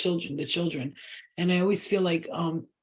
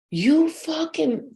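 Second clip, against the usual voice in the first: shrieking mock curse; 9 semitones higher, much slower than usual. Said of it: "she called me saying".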